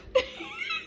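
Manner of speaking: high squeal of laughter; joyful laugh. Real, not acted. Praising God to viewers, squealing laugh.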